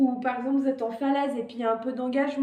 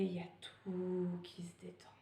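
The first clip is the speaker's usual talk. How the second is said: relaxed whisper; 6 semitones lower, 15 dB quieter than usual. Shown as a demonstration. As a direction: breathe it out in a soft whisper. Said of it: "Et quand vous expirez".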